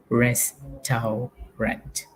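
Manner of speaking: restaurant drawn out slowly as res-tau-rant, every letter sounded; spelling pronunciation. Shown as a demonstration. Teacher to students, sounding out restaurant to remember its spelling. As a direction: stretch restaurant into its written syllables. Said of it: "in your mind, say it".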